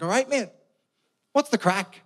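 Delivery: high, bright, loud matey hello; cheery Belfast greeting. Retold or imitated. Belfast lad greeting an English guy, cheery and high. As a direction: greet a stranger like an old pal. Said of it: "I was like".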